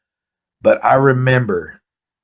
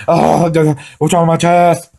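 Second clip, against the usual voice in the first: high, louder worried cry; alarmed about his chest; mock alarm; panicky cry; 6 semitones higher, 5 dB louder, slower than usual. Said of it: "I'm back tripping".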